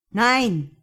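loud, high cry of refusal; shouted. A narrator's reading. Mark refuses drunk Josh's advance, shouted. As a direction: shout it out in one burst. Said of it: "schrie Mark".

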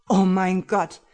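loud horrified cry; screaming. Acted. Husband screaming at the shadows, loud terrified cry. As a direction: scream it out in terror. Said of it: "schrie Tim erneut".

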